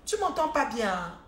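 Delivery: deliberately loud, slow and drawn out; playful loud joke about the volume; mock loud. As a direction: boom it out as if the mic is weak. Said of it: "Je parle pas fort".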